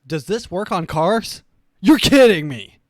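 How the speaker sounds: hammed-up outrage, loud; mock outraged shout. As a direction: shout it like you can't believe it.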